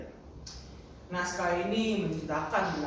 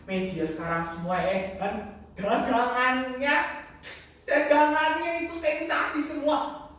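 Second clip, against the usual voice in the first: indignant rant, climbing higher; boiling anger; 9 semitones higher, 6 dB louder than usual. Acted. Grandfather rants about newspapers, boiling over. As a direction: rail at the press, building. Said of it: "Darahku mulai mendidih".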